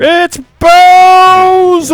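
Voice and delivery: shouted drawn-out name reveal; ring announcer. Pretend. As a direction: roar the fighter's name to the crowd. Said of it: "ring announcer voice or something".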